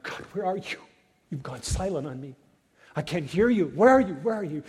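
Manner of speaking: voicing Habakkuk's anguished cry, rising loud. Prophet crying out to a silent God, rising to a shout. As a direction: plead out to God, voice climbing to a shout.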